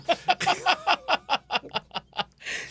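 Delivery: loud, high falling laugh; a big laugh rolling down in pitch; high burst of laughter. Real, not acted. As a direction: crack up at your own punchline. Big laugh at own punchline.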